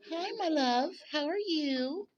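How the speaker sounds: cooing pet talk. Real, not acted. Owner greets her hen in high cooing baby talk. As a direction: coo at her like a beloved pet. Sweet-talking a pet bird.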